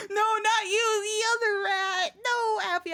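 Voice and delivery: high, loud shout through helpless laughter; laughing shout. Real, not acted. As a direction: yell it while cracking up. Laughing, correcting chat about rat.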